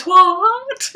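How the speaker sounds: very high rising mock What; Jackson's What. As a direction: squeak out What in disbelief. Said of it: "The way he says".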